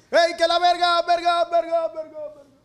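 criant fort avec un écho qui s'estompe; lance-le du haut de la colline et laisse l'écho mourir. Pretend. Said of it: "estoy jugando con el eco".

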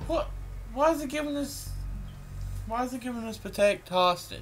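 high, exasperated why; baffled outrage at the game; indignant surprise. Real, not acted. Wrong map picked.

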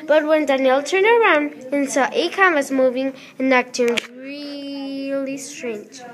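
plain reading voice

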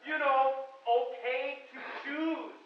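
mock politician spelling out the slogan slowly; exaggerated slow. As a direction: drag it out as if to a slow listener. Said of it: "That's an exaggeration".

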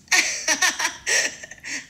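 high burst of laughter. Shown as a demonstration. Assistant cracks up at its own joke, laughing. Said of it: "une phrase en riant".

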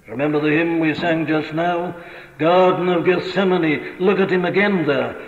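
ordinary talk

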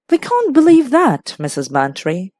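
high, teasing incredulity dropping on the name; mocking disbelief. A narrator's reading. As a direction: tease her with mock doubt. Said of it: "in mocking disbelief".

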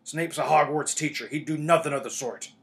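loud, indignant Hagrid; indignant defence. A narrator's reading. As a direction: defend him in a big, booming voice.

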